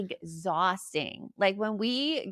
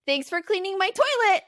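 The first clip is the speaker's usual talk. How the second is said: higher, louder gleeful taunt; cheeky kid punchline; 10 semitones higher, 5 dB louder than usual. Retold or imitated. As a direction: deliver the prank payoff proudly. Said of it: "He's like".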